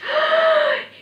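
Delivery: sharp, loud acted gasp; sudden gasp of terror; horrified gasp. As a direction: suck in air in shock. Boy sees the mountain witch.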